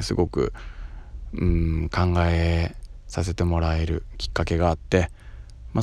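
usual speaking voice

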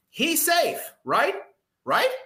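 high, insistent "he's safe, right?"; pleading fan. Retold or imitated. Fan at home begging the TV to agree, high and insistent.

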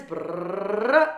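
short rising tongue drumroll; drum roll. Pretend. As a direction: finish the mouth drum roll. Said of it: "I'll do the drum roll".

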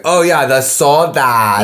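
put-on "the sodas" said slowly with a Spanish-style accent.

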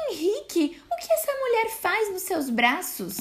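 high shocked wife. A narrator's reading. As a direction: gasp out Henrique in outrage. Wife finds husband holding wolf.

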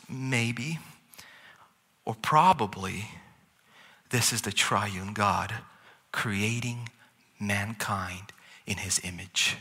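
ordinary talk, nothing marked

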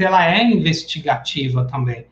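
normal talking voice

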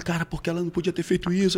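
high, soft, hurried plaint; retold tearful lament. Man lamenting her betrayal to her mother, softly plaintive. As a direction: mildly act out being close to tears. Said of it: "Eu comecei a chorar".

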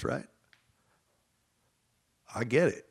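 ordinary talk

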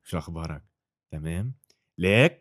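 lightly put-on Lebanese Arabic greeting; casual Lebanese phone chat; Lebanese accent. Shown as a demonstration. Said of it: "the Lebanese accent".

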